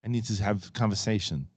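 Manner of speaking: put-on Arnold-style Austrian accent. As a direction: do an Arnold accent, picking a quarrel. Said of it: "like to hear Arnold and the Flirtenflaus".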